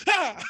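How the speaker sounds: short, high mock scream; sudden high yelp of remembered failure; acted scream. Man just showering and then, sudden mock scream. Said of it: "He's just showering and then".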